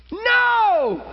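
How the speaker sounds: high, drawn-out preacher's cry; emphatic, high-pitched rebuke; fervent preaching. Real, not acted. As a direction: denounce it from the pulpit, pitched high. Preacher rejects the excuse.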